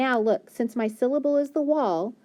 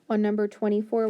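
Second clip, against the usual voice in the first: ordinary delivery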